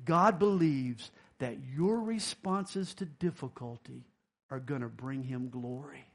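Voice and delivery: ordinary talk, nothing marked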